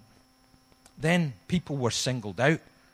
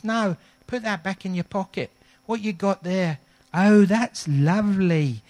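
higher, sweet landlady voice, slowing on the last words; Debbie's voice; 8 semitones higher, 4 dB louder than usual. Retold or imitated. Pub owner's wife welcoming the pastor, high and sweet. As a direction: play the kindly pub wife fussing over the cake.

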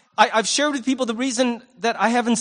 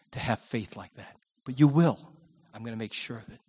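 tender reassurance in a hushed voice; gentle Jesus; 7 semitones lower than usual. Acted. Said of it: "And he's saying".

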